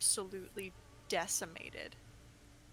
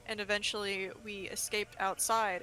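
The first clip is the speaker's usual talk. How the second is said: ordinary talk, nothing marked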